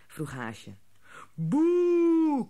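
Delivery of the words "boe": loud high boo; booing chorus. A narrator's reading. Offended gnomes boo from every corner, loud boo. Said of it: "klonk het uit alle hoeken".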